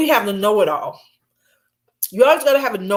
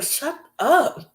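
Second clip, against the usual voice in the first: muttered shut up; 7 semitones higher, 4 dB quieter than usual. Retold or imitated. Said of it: "I'm like".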